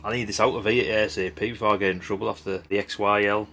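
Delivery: normal talking voice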